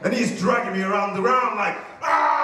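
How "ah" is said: loud pained yell. Retold or imitated. Kid in a headlock, loud pained yell. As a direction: cry out as you're grabbed. Being dragged by balls.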